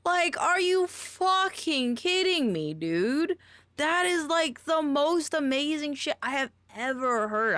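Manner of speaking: high-pitched thrilled disbelief; excited rave. Real, not acted. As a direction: gush like it's the best thing ever. Raving about a voice she loves.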